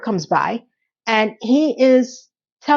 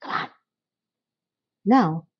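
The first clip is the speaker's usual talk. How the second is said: ordinary talk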